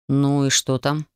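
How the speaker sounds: lightly muffle the question, a touch low; understated hollow, muffled tone. A narrator's reading. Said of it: "Донёсся до меня глухой голос инквизитора".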